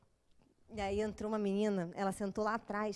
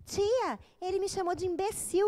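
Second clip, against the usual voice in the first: higher tattling voice; child complains to the teacher about being insulted, a little whiny; whiny complaining child; 7 semitones higher, 3 dB louder than usual. Retold or imitated. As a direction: tell on him to the teacher.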